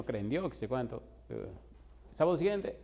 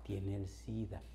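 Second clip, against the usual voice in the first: hushed, much softer, almost a whisper; said softly; 6 semitones lower, 10 dB quieter, much slower than usual. Retold or imitated. Girl quietly tells the pastor her brother's illness, hushed. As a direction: drop the voice low as if it's a secret. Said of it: "me dijo bajito".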